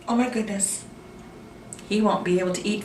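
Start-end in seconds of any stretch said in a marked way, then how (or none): 0.0-0.8 breathy whispered shock after a gasp; gasp, then whisper it in amazement. Real, not acted. Storyteller shocked the shark lost his teeth, whispered gasp. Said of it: "gasps at shark's teeth".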